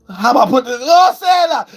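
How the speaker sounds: high, loud burst; excited shout. Real, not acted. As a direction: cry out as it clicks. Excited exclamation at viewers.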